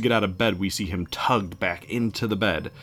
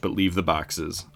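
ordinary delivery

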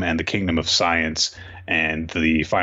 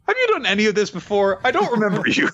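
high-pitched comic confusion; mock bewilderment; 12 semitones higher, faster than usual. Reader to a forgotten character, high mock bewilderment. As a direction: question it in a put-on high voice.

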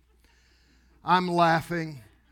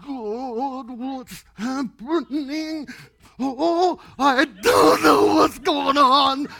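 high, drawn-out wail building to a shout; panicked cry; 9 semitones higher, 8 dB louder, much slower than usual. Retold or imitated. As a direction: re-enact helpless bewilderment, louder and louder. Re-enacting his panic while shaking.

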